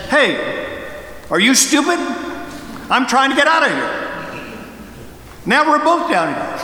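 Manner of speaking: exasperated complaint. Acted. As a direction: scold the friend for jumping in, half-amused. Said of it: "The man immediately says".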